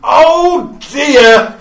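high, loud comic exclamation; sitcom catchphrase. Pretend. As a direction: belt it out like a sitcom star. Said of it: "You need to coin one now".

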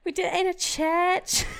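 high, loud mock British confession; British accent. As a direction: squeal it in a hammed-up British accent. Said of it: "should I do it in a British accent?".

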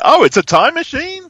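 sudden penny-drop disbelief at it being a time machine; a jump up in pitch and volume on "Oh", rising at the end; surprised realization. Retold or imitated. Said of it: "And I was like".

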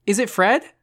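eager, hopeful question; excited. A narrator's reading. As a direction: ask it with excitement. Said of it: "sounding excited".

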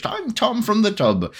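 high, slow old-school British cartoon voice; Tom from the Tub. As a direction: introduce yourself like a British cartoon character. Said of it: "old school British".

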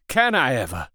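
ordinary delivery, nothing marked

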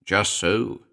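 usual speaking voice

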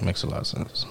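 under the breath, nearly voiceless; whispered agreement. Real, not acted. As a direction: breathe it to yourself.

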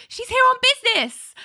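high, insistent; defensive squeak. Retold or imitated. Ally defensive to boss.

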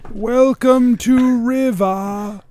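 very slow, drawn-out greeting; slow turtle voice. Acted. Turtle welcomes the travelers to his town, very slowly. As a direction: draw each word out like a turtle.

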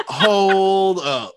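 startled double-take. Retold or imitated. Retelling going back to the scene, startled. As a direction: act out stopping in disbelief.

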